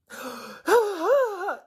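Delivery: a loud, high, shocked gasp; hammed-up outraged gasp; sharp high gasp. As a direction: gasp as if scandalised. Mock shock at stolen anatomy.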